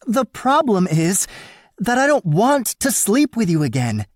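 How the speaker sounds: high, loud indignant woman's voice. A narrator's reading. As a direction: insist hotly, voice pitched high. Woman insists angrily.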